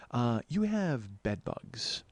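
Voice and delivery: slow, quiet deadpan; deadpan announcement. Retold or imitated. Telling the clerk the hotel has bugs in slow deadpan. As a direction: drop it flatly and slowly.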